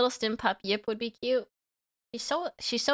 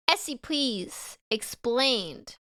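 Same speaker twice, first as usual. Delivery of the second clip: slow dramatic title; much slower than usual. Shown as a demonstration.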